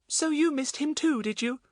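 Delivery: put-on high-pitched, sympathetic child voice; a high little voice, feeling for the dog; sympathetic little girl. A narrator's reading. Betts talking to Buster who looked sad, high and sympathetic.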